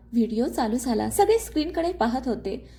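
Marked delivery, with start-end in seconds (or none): none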